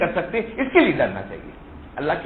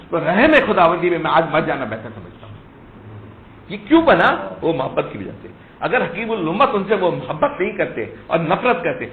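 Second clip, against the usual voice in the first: fiery preaching; 4 semitones higher, 7 dB louder than usual. Acted. Impassioned sermon passage.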